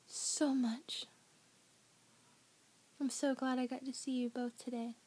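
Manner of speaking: soft, hushed goodnight, nearly whispered at times; tender bedtime goodnight. Real, not acted. Parent saying goodnight to children, soft and tender. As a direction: send them gently to sleep.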